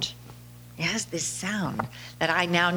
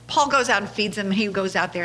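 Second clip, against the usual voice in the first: ordinary talk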